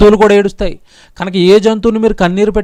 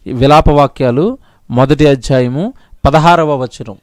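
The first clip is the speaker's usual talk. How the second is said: ordinary delivery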